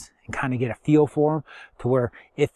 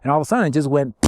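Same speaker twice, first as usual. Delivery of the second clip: normal talking voice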